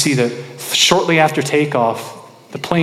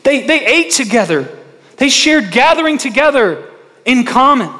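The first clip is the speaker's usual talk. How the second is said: high, loud, fervent sermon delivery; preaching at full force; 8 semitones higher, 5 dB louder than usual. Acted. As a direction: preach it out to the congregation.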